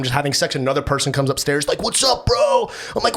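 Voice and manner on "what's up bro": bro greeting. Retold or imitated. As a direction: call out a loose dude hello. Another friend greeting voiced.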